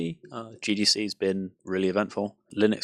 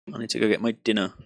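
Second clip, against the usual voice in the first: ordinary talk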